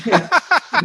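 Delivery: sudden high-pitched giggle; high embarrassed laugh. Real, not acted. Apologising for talking too much.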